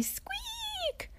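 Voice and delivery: very high, soft little squeak; tiny squeak. A narrator's reading. Mister Strong hears trapped Tiny, tiny squeak. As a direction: squeak the word out tiny and thin. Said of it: "he heard a very tiny".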